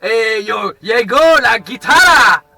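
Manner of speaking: put-on accent mexicain appuyé, mêlé d'espagnol; mock Mexican accent. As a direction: joue un Mexicain caricatural. Fake Mexican persona throughout. (accent mexicain caricatural)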